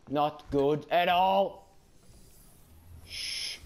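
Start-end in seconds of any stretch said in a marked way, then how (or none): 3.0-3.6 soft hush to stay hidden; breathy shh, barely there; shushing whisper. Real, not acted. Hiding from the alien.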